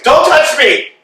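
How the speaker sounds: high, loud snap; shouted refusal. Acted. Estragon fends off Vladimir's embrace, shouting. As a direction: bark it at him to keep him off.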